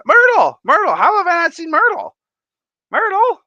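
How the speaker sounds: high, loud excited shout; shrieky, thrilled call-out; excited greeting. Real, not acted. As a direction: holler the name in delighted surprise. Excitedly spots a viewer in chat.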